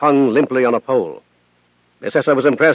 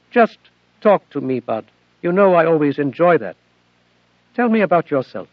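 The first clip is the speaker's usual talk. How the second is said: put-on AI girl Athena; 3 semitones higher than usual. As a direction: plead gently as a devoted daughter. Narrator voices Athena.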